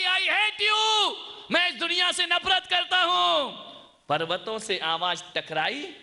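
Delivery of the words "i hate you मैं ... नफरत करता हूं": put-on child shouting. Angry child shouts his hate at the hills. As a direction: hurl it at the mountains. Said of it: "उसने आवाज लगाई".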